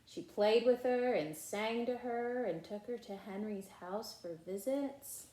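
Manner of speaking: put-on lively read-aloud voice for children; animated storybook reading with character lines; story narration. A narrator's reading. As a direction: read it like a bedtime picture book. Reading picture book aloud.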